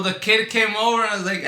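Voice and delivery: ordinary delivery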